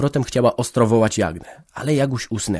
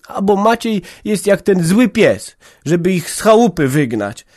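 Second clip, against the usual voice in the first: high, louder heated outburst; passionate exclamation; 9 semitones higher, 5 dB louder than usual. A narrator's reading. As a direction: fling it out with passion. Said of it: "wykrzyknęła namiętnie". (namiętny wykrzyk)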